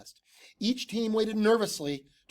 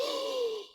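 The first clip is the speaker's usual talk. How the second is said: audience gasp. Acted. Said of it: "The audience gasped".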